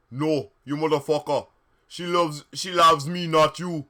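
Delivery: put-on loud Jamaican-accented shouting. Vampire claiming her love, Jamaican-accented shout. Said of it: "Jamaican, Jamaican".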